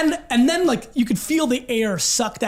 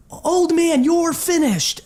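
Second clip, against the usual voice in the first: raised, high-pitched heckle; taunting call; 7 semitones higher, 4 dB louder, much slower than usual. Retold or imitated. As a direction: jeer at a rival fan across the stands. Repeating the taunt from his story.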